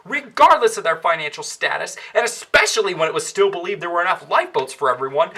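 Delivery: ordinary talk, nothing marked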